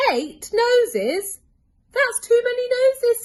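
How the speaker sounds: high, loud shocked cry; rising alarm at one too many; alarmed surprise. A narrator's reading. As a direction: squeal of disbelief. Kipper shocked by extra nose.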